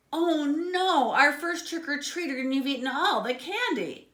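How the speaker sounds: high, loud panicky pig; panicked pig. A narrator's reading. Inventor pig panicking at the first trick-or-treater. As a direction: fret in a rush of alarm.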